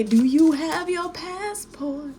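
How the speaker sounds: mock R. Kelly asking about the trip; R. Kelly impression. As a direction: drawl the questions out slow and high like R. Kelly. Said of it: "Like what R. Kelly say".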